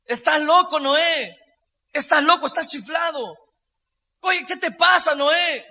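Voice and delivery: retold yells at a madman; mock jeering shout. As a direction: call out like scoffers taunting Noé. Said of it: "le empezó a gritar".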